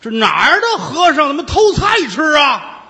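high, loud yell from afar; shout from outside. Acted. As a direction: call it out across the yard. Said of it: "听到外边喊".

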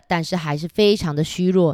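usual speaking voice

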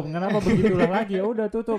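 high, laughing tease. Real, not acted. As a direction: laugh while teasing. Teasing a repeated sign-off. (menggoda sambil tertawa)

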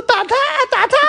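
very high, laughing cheer; egging on. Retold or imitated. Girl squealing for the fight. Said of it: "然后我就说".

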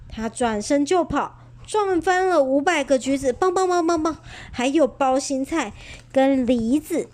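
ordinary delivery

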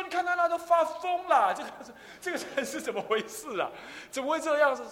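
高声惊呼，难以置信; shocked onlookers. Retold or imitated. 众人惊见禅师鞭尸. (众人看到禅师鞭打母亲尸体，惊叫)

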